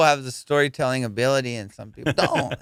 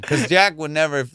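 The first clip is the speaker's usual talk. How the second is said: ordinary talk, nothing marked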